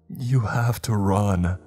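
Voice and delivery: slow, shaky and high with fear; trembling voice. A narrator's reading. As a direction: tremble out a frantic warning. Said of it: "he spoke with a trembling voice".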